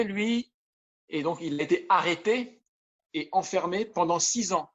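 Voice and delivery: ordinary delivery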